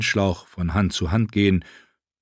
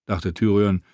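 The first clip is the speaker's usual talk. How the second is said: plain reading voice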